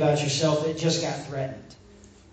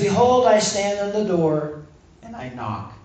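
gentle invitation. Acted. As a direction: offer it gently, quieting right down at the end. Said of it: "He says".

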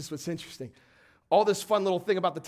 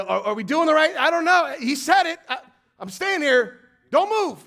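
higher, louder anxious priests; jittery priests holding their ground; nervous priests; 8 semitones higher, 8 dB louder than usual. Retold or imitated. Nervous priests standing waiting.

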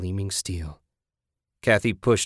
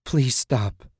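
high, short desperate plea; pitched-up, urgent begging; frightened pleading; 5 semitones higher than usual. A narrator's reading. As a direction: beg the attacker to stop. Victim begging attacker to stop.

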